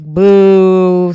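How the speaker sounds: long loud boo; mock heckling. Host heckles Daisy's unfunny mocking, loud drawn-out boo. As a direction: boo them like a heckler in the crowd.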